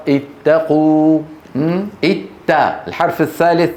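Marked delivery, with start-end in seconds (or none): 0.1-1.2 اتقوا drawn out slowly, each sound clear; slowed word. Shown as a demonstration. Teacher to class, spelling out اتقوا slowly. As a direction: stretch اتقوا out syllable by syllable. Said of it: "slow demo of the form".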